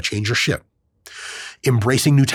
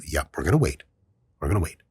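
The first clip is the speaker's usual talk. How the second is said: much lower and softer, unbothered; low quiet calm answer; calm low reply; 8 semitones lower, 5 dB quieter, faster than usual. Retold or imitated. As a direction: settle it down low and patient.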